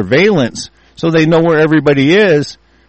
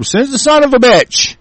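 higher, defiant yell; defiant shout; 10 semitones higher than usual. Real, not acted. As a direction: dare them to send him. Defiant reply to threat.

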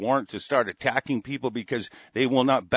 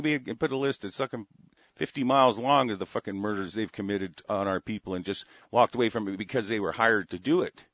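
normal talking voice

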